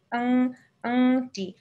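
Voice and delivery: আং, আংটি stretched out with a long ng hum; slow ng demo. Shown as a demonstration. Teacher modelling ng for the child, very slow. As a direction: spell out আং slowly, then আংটি. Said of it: "উচ্চারণটা হয়ে যাবে আং".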